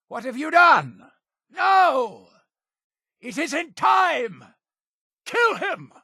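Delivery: very high, panicked shouting; frantic cult leader's shout; alarmed outcry. Acted. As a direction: cry out in horror as the plan goes wrong. Leader cries out at sudden betrayal.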